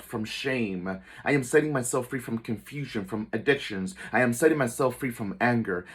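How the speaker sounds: powerful declaration. Shown as a demonstration. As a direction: declare each release with full conviction. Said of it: "say it with power".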